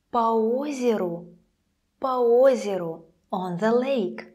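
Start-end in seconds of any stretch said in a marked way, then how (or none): 0.1-3.0 "По озеру" as "pa-O-zeru"; a in по, full stressed о in "озеру"; po before stressed o. Shown as a demonstration. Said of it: "it would sound like this".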